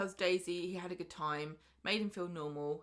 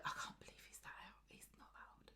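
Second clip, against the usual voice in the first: hushed, disbelieving whisper; mock whisper; 17 dB quieter, faster than usual. [name] reacting to the lie about his age, whispered disbelief. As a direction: whisper it like scandalised gossip.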